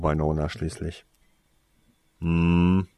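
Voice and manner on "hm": loud hummed "hm" of frustration; frustrated agreeing grunt. A narrator's reading. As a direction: grumble in reluctant agreement. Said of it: "brummte er frustriert und zustimmend". (frustriert zustimmendes Brummen)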